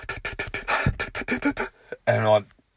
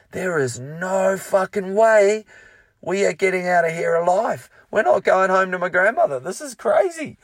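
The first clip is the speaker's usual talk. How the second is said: panicked thought; 11 semitones higher, 7 dB louder than usual. Retold or imitated. Panicked thought in crowd chaos.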